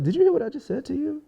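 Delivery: quick, high-pitched imitation of her disbelief; retold incredulous question. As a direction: ask it fast and high, as her.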